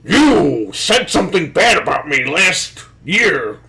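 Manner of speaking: slow, deadpan whiny promo; mock boring wrestler. Host mocking a slow, dull wrestler promo, deadpan. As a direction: drag it out like a dull scripted wrestler. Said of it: "I don't want these guys to come out and be like".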